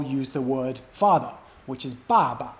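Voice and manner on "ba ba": "ba ba" with a falling first syllable and short neutral second; falling "ba", then a brief unstressed "ba"; fourth then fifth tone. Shown as a demonstration. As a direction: stress the first "ba", let the second drop off. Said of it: "first ba is fourth tone".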